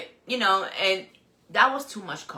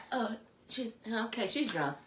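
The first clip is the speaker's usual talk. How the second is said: quiet bougie voice; 11 dB quieter than usual. Retold or imitated. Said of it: "she sound bougie".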